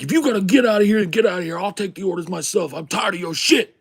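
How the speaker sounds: high-pitched fed-up tirade; angry yelling. Retold or imitated. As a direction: imitate a cook losing it at a coworker. Retold cook tells off waitress.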